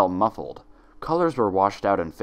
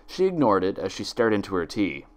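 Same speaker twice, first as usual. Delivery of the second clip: ordinary delivery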